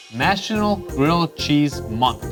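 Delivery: ordinary talk, nothing marked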